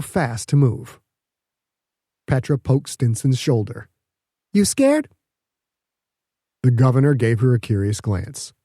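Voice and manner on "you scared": high, teasing poke of a question; playful tease. Acted. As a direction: needle her playfully, pitched way up. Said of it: "in a playful".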